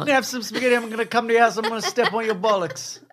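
high mock British chef, comic threat; Jamie Oliver impression. Husband interrupts recipe with threats, high British chef voice. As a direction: menace playfully as a British TV cook. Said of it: "It's Jamie Oliver".

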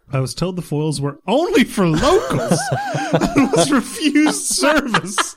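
slow, high reading through laughter; laughing while reading. Real, not acted. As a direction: read it while cracking up. Reading listener letter, laughing.

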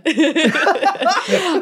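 a high, loud burst of real laughter. Real, not acted. Laughing at her own joke about Bradley Cooper.

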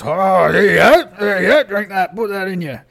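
rowdy orc tavern host. Acted. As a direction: push a drink on them as a boisterous orc. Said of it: "the orc brings out the drink and goes".

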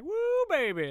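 high squealed woo; a playful high-pitched whoop; mock woo. Joking about the cook cheering, with a high mock whoop. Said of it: "Was she just like".

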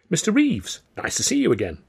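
high lift on the name, then settle; lightly voiced receptionist's welcome; receptionist greeting. A narrator's reading. Receptionist greets Mister Reeves, cheery greeting.

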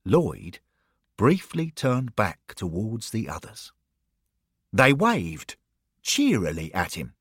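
ordinary delivery

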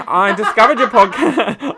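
put-on high, loud Australian accent breaking into laughter; laughing through a put-on Australian accent. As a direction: start reading as an Aussie, cracking up. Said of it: "read this in my Australian accent".

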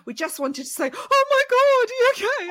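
high, loud horrified gushing; mock shocked concern. Couple finding the comedian sobbing, hammed-up alarmed concern. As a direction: imitate an aghast, fussing well-wisher. Audience walks in on her sobbing.

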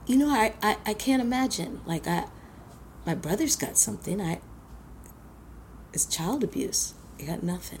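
soft, sorry-for-herself mumble; pitiful quiet plea. Retold or imitated. Girl telling her uncle she got nothing, softly pitiful. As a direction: play the neglected child for sympathy.

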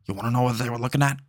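fast, gruff mobster growl; Al Pacino impression. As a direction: lean in like a smug detective. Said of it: "And then Al Pacino goes".

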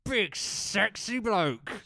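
slow drawn-out teasing voice; teasing drawl. Pretend. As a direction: tease your mate, stretching it. Teasing intro.

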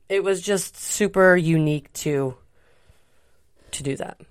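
talking through a burp. Real, not acted. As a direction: speak around a small burp. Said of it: "Sorry, I'm burping".

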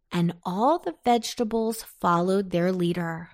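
ordinary delivery, nothing marked

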